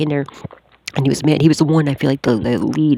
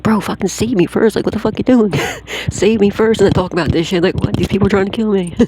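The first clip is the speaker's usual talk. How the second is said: exasperated rant; 5 semitones higher than usual. Retold or imitated. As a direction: vent at them like they've lost their minds. Exasperated retort to rescuers.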